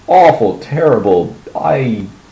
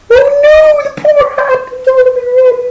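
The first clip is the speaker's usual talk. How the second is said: much higher, slow, hammed-up mock sob; mock wailing; 4 dB louder, much slower than usual. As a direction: wail it like a weepy contestant. Mocking Americans crying at anything.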